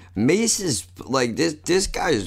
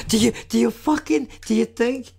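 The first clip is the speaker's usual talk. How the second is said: high, fast, squeaky taunting; squeaky mock voice; 12 semitones higher than usual. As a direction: pitch way up and mock him.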